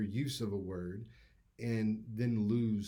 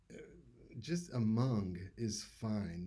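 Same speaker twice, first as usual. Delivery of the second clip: ordinary talk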